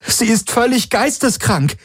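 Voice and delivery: high-pitched outraged cry; high, appalled outburst; called out. A narrator's reading. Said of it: "rief Kirai".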